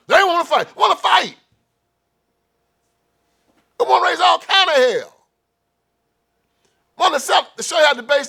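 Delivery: rising angry shout over a crowd that wants to fight; heated shouting. Real, not acted. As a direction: yell it out in real outrage. Heated rant about people wanting fight.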